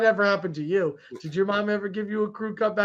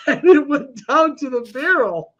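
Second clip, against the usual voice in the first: slower, words breaking into real laughter; laughing through words; 5 semitones higher, 4 dB louder, slower than usual. Real, not acted. Brother laughs out the clipper mishap to his cohost. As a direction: laugh while telling him how bad it got. Laughing while telling haircut story.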